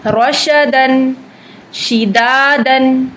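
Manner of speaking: रशदन drawn out slowly, sheen spreading; slow drill words. Shown as a demonstration. Teacher reciting practice words slowly. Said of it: "शीन की आवाज़ फैलेगी".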